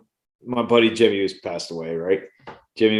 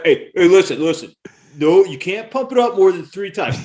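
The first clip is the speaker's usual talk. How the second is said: bossy rule-setting; 8 semitones higher, 5 dB louder than usual. Retold or imitated. Kid laying down BB gun rules.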